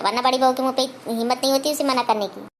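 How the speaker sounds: usual speaking voice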